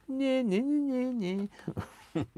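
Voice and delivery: high, mocking nyeh-nyeh taunt; taunting squirrel. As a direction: tease like a cheeky squirrel. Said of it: "almost going".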